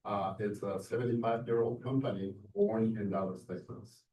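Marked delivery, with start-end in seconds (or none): none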